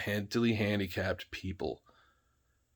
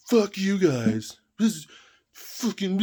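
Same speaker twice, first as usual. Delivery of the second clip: high, slow, whiny mock of the angry friend; Nathan storming off; 10 semitones higher, 4 dB louder, slower than usual. Mocking Nathan as he storms off from the group, high whiny voice. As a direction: squeal it like a hurt kid storming off. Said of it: "And then Nathan would go".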